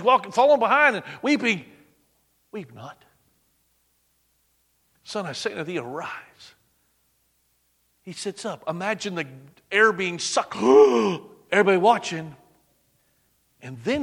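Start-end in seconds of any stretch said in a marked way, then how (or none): 2.5-6.5 soft, hushed and tender; tender comfort. Acted. Jesus softly tells the weeping mother, hushed tenderness. 10.6-11.2 loud shocked intake of breath; sharp gasp. Acted. Mourners see the dead man sit up, a loud gasp.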